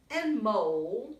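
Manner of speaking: ordinary delivery, nothing marked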